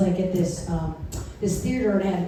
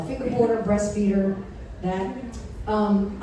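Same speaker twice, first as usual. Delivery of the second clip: ordinary talk, nothing marked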